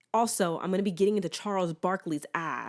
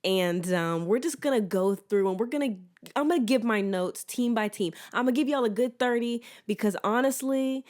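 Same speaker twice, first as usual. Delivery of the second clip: usual speaking voice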